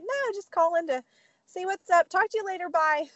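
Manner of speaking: high, breezy cover-up; fake casual. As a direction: wrap up the call innocently, pitched high. Covering up to mom.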